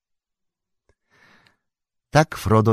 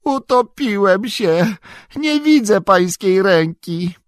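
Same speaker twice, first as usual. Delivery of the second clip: high, slow drowning wail; drawn-out cry of someone going under; panicked moan; 13 semitones higher, 4 dB louder, slower than usual. Acted. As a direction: wail helplessly, pitched way up. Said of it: "jęknął sam".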